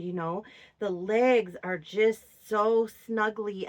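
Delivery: normal talking voice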